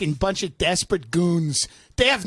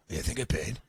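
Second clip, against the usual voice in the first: very low, dull confession; deep lumbering suspect caught out; dopey executive; 14 semitones lower, 10 dB quieter, faster than usual. Pretend. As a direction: sheepishly own up to the free dinner.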